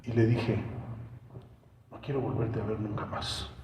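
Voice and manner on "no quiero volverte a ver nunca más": low, hushed retold farewell; quiet finality. Woman ending it with her lover, low and hushed. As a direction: drop right down, soft and final.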